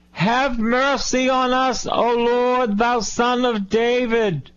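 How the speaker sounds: high, drawn-out hollered plea; insistent crying out. Acted. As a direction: cry out high and slow, insisting to be heard. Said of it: "they cried out louder the more".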